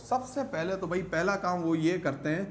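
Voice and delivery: usual speaking voice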